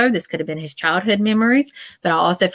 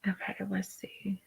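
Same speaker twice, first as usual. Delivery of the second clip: barely audible whisper; hushed, absent-minded self-talk; 19 dB quieter than usual. Real, not acted. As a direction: murmur it to yourself while reading. Reading comments to herself.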